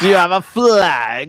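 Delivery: loud mock demand; mock shouted quote. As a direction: punch it out loud, like a sudden challenge. Quoting the comedian's punchline.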